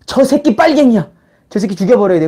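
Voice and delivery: mild imitation of a hateful fanatic; mock fanatic. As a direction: spit out the charge like a zealot. Zealot denouncing a dissenter.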